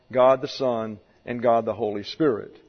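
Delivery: ordinary talk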